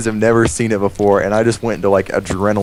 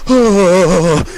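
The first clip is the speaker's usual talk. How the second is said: loud high ah, hammed up; a quick bright ah while brushing past; mock ah; 10 semitones higher, 5 dB louder than usual. Touching kids in the hallway, loud high mock ah. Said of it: "and go".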